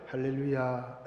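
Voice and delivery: mock pious believer. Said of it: "이러면서".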